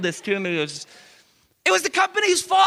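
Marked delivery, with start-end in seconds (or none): 1.6-2.7 triumphant shout. Acted. Preacher proclaiming to his church whose fault it was, shouting.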